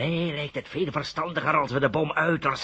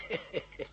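breathy scoffing chuckle; scornful laugh; 4 semitones higher, 13 dB quieter than usual. Acted. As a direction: laugh off the young man's naive idea. Professor laughs at the claim.

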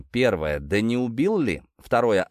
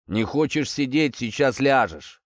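lazy snap back; 3 semitones higher than usual. Acted. Police chief answering the cheeky detainee, lazy retort. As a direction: brush him off lazily. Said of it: "лениво огрызнулся старший".

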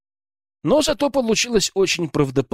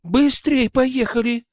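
eager urging; 10 semitones higher, slower than usual. A narrator's reading. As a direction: hurry them out excitedly. Excited Alyona jumping up.

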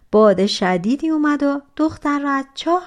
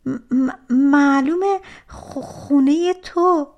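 slow, halting, fearful stammer rising higher; scared stammer; 5 semitones higher, 3 dB louder, slower than usual. Acted. Frightened girl stammering her answer to the giant. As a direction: stumble over each word as if terrified.

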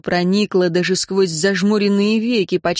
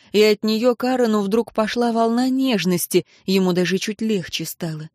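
ordinary delivery

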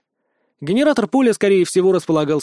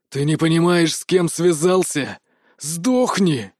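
menacing, drawn-out threat; angry growl; slower than usual. A narrator's reading. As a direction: snarl it at a despised enemy. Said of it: "прорычал граф".

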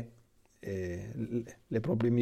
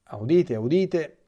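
town crier; 7 semitones higher, 6 dB louder, slower than usual. Pretend. Announcing news like a crier.

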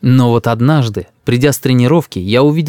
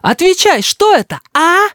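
put-on very high child interrogation; demanding little boy. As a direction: demand an answer like an angry kid.

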